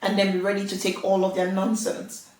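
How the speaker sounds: ordinary delivery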